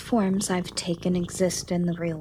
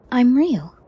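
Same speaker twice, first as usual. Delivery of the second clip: emphatic insistence; 4 semitones higher, 9 dB louder than usual. Acted. Insisting to doubting listener.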